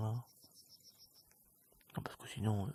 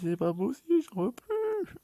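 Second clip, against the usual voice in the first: squeak, much higher, fed up; exasperated squeal; 11 semitones higher than usual. Real, not acted. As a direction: squeal it out in despair.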